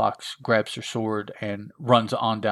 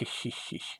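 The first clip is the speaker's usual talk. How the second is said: soft, voiceless wicked snicker; villain's snicker; 13 dB quieter than usual. Acted. Creature threatens captive with his boys, sly snicker. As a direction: snicker quietly after a threat.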